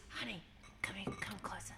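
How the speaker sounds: hushed, breathy mock deathbed whisper. Mocking podcast father on deathbed, hushed mock whisper. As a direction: whisper it like a dying dad. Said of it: "on his deathbed, he says".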